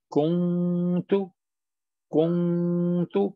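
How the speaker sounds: कुंतु with a drawn-out hidden n before tu; nasal ikhfa. Shown as a demonstration. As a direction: hum the noon of कुंतु long in the nose. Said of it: "हम छुपा रहे हैं".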